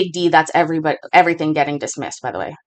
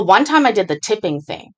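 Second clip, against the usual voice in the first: ordinary talk, nothing marked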